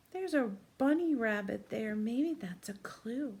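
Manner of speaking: ordinary talk, nothing marked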